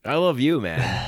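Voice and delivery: high, loud and gushing; affectionate burst. Real, not acted. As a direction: blurt it out with real affection. Affectionate outburst ending the podcast.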